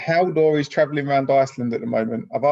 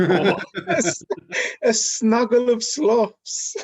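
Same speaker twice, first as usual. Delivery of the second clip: high, slow, giggly coo; cooing through laughter; 11 semitones higher, 4 dB louder, much slower than usual. Real, not acted. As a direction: savour the cute word, laughing. Savouring the cute word.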